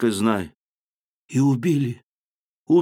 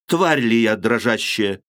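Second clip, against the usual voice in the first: ordinary delivery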